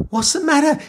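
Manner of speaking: high, worried mum; mother's voice. Acted. Mother asking Jack what's wrong in a high voice. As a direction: ask it as the alarmed mother.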